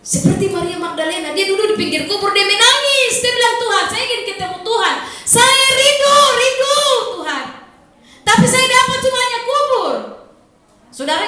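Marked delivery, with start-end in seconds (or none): 0.1-9.9 much higher, louder impassioned preaching; preaching at full force. Acted. Pastor urging worshippers to long for God, impassioned. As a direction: cry out to the people with fervour.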